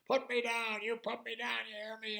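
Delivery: high, loud indignant yelling; screaming. Acted. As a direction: yell angry demands. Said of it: "this voice screaming in front of her".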